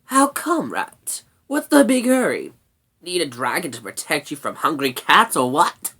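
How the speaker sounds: loud, cheeky brownie needling; lightly voiced cheeky creature; teasing brownie. A narrator's reading. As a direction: tease the rat with pointed questions. Brownie Sorrel teases the rat.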